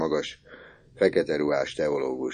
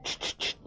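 unvoiced, very soft shh shh shh; shushing hiss; 12 dB quieter than usual. Orci hushes the classmates, soft urgent shushing. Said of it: "mondta Orci".

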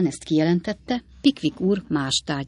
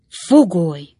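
loud, fervent outburst; heated exclamation; 7 dB louder, slower than usual. A narrator's reading. Friend reacts to Sam being a prisoner, crying out fervently. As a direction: cry out the single word with force. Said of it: "kiáltott fel Winkle úr szokatlan hívvel".

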